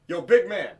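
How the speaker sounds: high, loud mock call; imitated street yell. Imitating someone yelling at him as he walks, calling out. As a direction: holler like a stranger after someone's attention. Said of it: "I hear someone yell".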